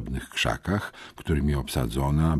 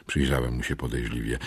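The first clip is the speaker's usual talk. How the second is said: ordinary delivery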